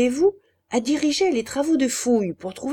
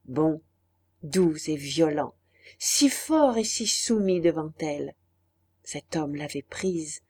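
ordinary delivery, nothing marked

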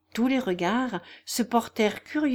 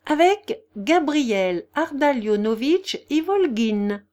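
slow, loud, each syllable set apart; measured, sharply separated syllables; 5 semitones higher, 5 dB louder, slower than usual. A narrator's reading. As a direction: spell the name out deliberately. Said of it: "en détachant nettement chaque syllabe".

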